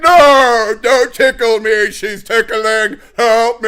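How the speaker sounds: loud, high mock-panicked pleas; panicked tickle yell. Retelling yelling at Britt while tickled, mock-panicked shout. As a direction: shriek for help while being tickled. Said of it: "I was like".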